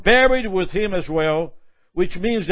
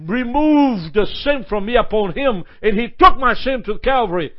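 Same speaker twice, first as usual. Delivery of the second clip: louder, higher, fervent proclamation; preaching crescendo; 5 semitones higher, 5 dB louder than usual. Acted. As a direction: preach it with rising fire. Preaching at full force.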